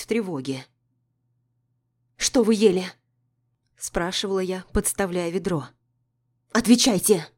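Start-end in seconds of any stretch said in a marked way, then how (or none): 2.2-2.8 loud, sharp urgent question; urgent demand. A narrator's reading. Narrator demands answer urgently. 6.5-7.3 loud demanding order; urgent command. A narrator's reading. Narrator demands answer urgently.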